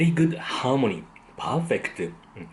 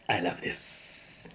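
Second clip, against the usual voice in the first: slow hushed whisper; 5 semitones lower, 8 dB quieter, slower than usual. Real, not acted. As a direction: breathe it out, savoring. Savoring ramen, repeats it whispered.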